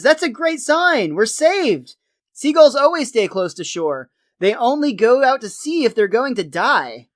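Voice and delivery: put-on neighborly optimism; hopeful Flanders. Flanders notices a seagull overhead, excited relief.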